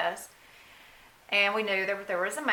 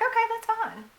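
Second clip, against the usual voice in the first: naive, breezy okay, pitched much higher; oblivious okay. Retold or imitated. Mother retelling her unaware reply to the doctor, breezy and high. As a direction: sound cheerfully unaware. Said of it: "I was oblivious".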